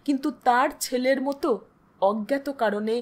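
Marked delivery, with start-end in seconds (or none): none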